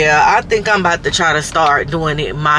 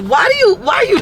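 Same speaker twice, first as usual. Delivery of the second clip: incredulous question. Retold or imitated. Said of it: "I'm like".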